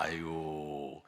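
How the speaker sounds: usual speaking voice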